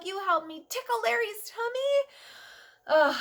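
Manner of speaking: plain reading voice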